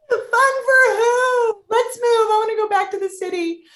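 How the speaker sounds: high, loud, half-crying yell, stretched at first; wailing outburst. Retold or imitated. Wife protesting the pregnancy to husband, high crying yell. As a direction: wail it like a panicked protest.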